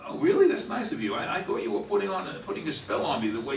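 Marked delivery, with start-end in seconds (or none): 0.1-0.5 high, loud, delighted surprise; surprised. Acted. Joe surprised the kid is summoning an angel, high surprise. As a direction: jump up in pitch with disbelief.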